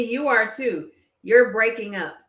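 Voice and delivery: ordinary talk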